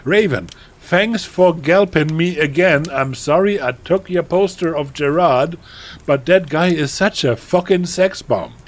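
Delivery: put-on high, loud, gushing teenage voice; melodramatic teen author. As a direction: read it like an overexcited goth girl. Reads author's note in character.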